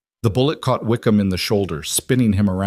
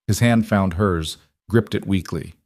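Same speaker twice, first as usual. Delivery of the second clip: plain reading voice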